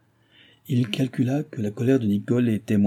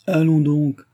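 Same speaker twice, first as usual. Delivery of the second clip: higher, louder scornful scoff; disdainful smile; 6 semitones higher, 6 dB louder, slower than usual. A narrator's reading. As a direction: brush him off with contempt. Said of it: "avec un sourire de dédain".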